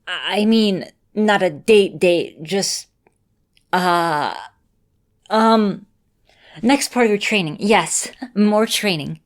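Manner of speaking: embarrassed stammering denial; flustered backpedal. Acted. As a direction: scramble to take back the slip. Backtracking after calling it a date.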